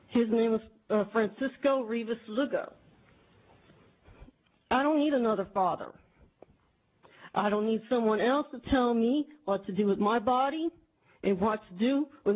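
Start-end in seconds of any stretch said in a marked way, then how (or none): none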